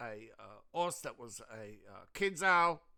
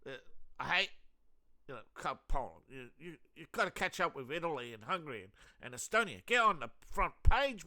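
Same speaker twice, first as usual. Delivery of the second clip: ordinary talk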